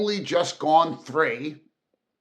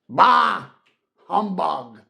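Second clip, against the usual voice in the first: loud, scoffing bark of contempt; scornful dismissal; 4 semitones higher, 9 dB louder, slower than usual. Acted. Scrooge brushing off his nephew's greeting, loud scoffing bark. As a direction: spit it out like a miser. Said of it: "said Scrooge".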